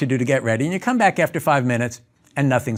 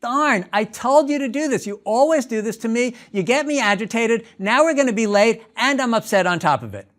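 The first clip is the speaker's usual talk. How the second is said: mock yell; 8 semitones higher than usual. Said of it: "you start screaming".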